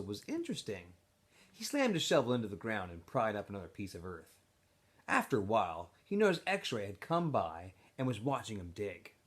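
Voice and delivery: expressive read-aloud of a chapter; story narration. A narrator's reading. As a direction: tell the story to a classroom. Teacher reads novel aloud.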